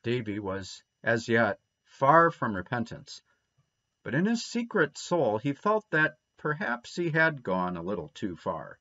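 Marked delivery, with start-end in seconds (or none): none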